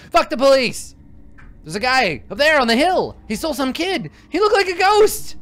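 high, loud panicked yelling; frantic shouting. Acted.

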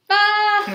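big sung-out pretend laugh. Storyteller laughs on cue at the silly rhyme. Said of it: "laugh like it is the silliest".

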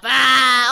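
loud battle yell; loud, open "ah" scream. Pretend. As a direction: yell out as you charge in. Attacks the fan in game.

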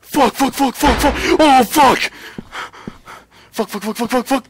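very high, loud, shrieking panic; terrified screaming. Real, not acted. Player trapped with the monster behind him, screaming in terror.

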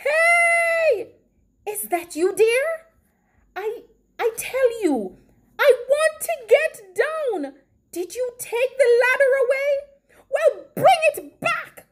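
high, insistent calling from afar; yelling. A narrator's reading. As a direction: yell high, hurrying the demands. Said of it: "The loud voice yelled again".

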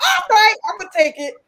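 high, loud nervous excitement; excited. Retold or imitated. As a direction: blurt it out, thrilled and scared. Retelling taking the mic nervously.

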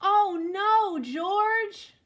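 loud, high cry of dismay; exasperated cry. Acted. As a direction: call out the dog's name in mock despair. Exasperated cry at dog's mischief.

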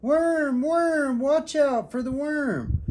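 put-on high, loud kids' alarm; high loud cries of worm; children yelling. A narrator's reading. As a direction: yell a warning like a class of kids. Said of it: "All the children started yelling".

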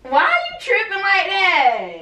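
high, loud exasperation; exasperated protest. Real, not acted. Friend pushing back in wallpaper quarrel, exasperated. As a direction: protest loudly with the pitch jumping up.

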